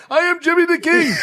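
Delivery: very high, loud mock African-accented boast; Jimmy the King. Friend imitates the African cab driver, high loud boast. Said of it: "very thick African accent".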